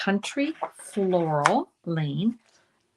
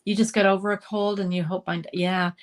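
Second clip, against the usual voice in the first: ordinary delivery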